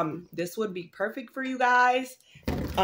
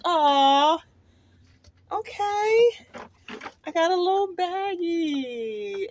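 delighted; 9 semitones higher, slower than usual. Real, not acted. Opening a gift package.

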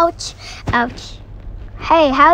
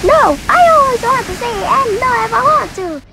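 character voice; 8 semitones higher, 3 dB louder than usual. Pretend. Voicing game character.

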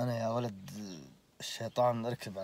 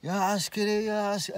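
retold calling out; 8 semitones higher than usual. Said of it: "صار يصيح وينادي الدورية".

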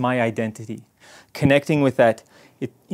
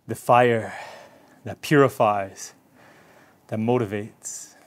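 guided meditation; 3 semitones lower than usual. Acted. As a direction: ease them inward with a hushed calm voice. Guided meditation after practice.

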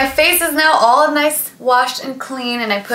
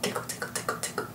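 whispered tickle chant; giggly tickle attack; 17 dB quieter, faster than usual. Acted. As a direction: softly tease tickle tickle.

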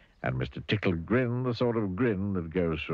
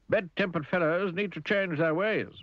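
cheerful voice; 10 semitones higher than usual. Acted. Happy lectures Grumpy.

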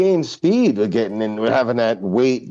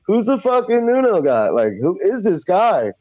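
higher-pitched mock bafflement; incredulous quote, raised pitch; baffled listeners; 8 semitones higher than usual. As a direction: imitate puzzled fans, voice pitched up. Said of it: "Everybody's like".

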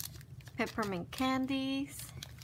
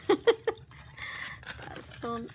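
giddy laugh; 6 semitones higher, 4 dB louder, much slower than usual. Real, not acted. Overwhelmed by gifts, laughing.

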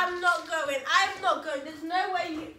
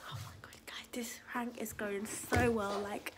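secret, hushed whisper; whispered aside; 11 semitones lower, 12 dB quieter than usual. Real, not acted. Child confiding to viewers that the prank works, whispered.

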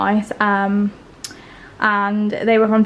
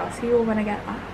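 ordinary delivery

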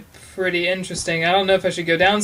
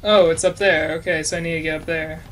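normal talking voice

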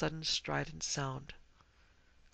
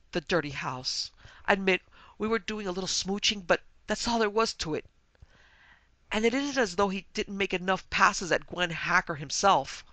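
higher, distraught protest with pauses; sobbed complaint; 4 semitones higher, 6 dB louder than usual. Acted. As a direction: pour out an acted tearful grievance. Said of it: "she sobbed".

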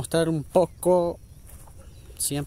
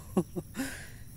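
short laugh; 9 semitones higher, 7 dB quieter than usual. Real, not acted. Laughing at mistaken snake.

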